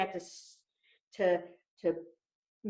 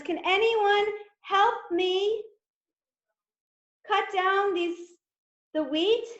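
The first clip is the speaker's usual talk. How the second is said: little hen voice; 9 semitones higher, 3 dB louder than usual. Acted. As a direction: ask sweetly like a small hen. Hen asks friends.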